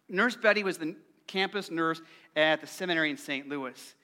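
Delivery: usual speaking voice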